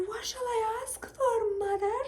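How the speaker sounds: put-on high, slow, whimpering girl voice; little girl asking. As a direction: play the small daughter pleading with mother. Girl asks her mother, acted.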